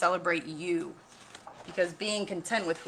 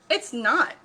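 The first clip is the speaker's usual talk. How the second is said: high and loud protest; a small voice standing its ground; little elephant insists; 7 semitones higher, 8 dB louder than usual. A narrator's reading. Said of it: "Num Num said".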